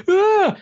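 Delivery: short high yelp of a scream; distant scream. Retold or imitated. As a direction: cry out sharply from far off. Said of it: "you sort of hear a".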